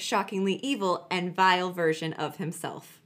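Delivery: usual speaking voice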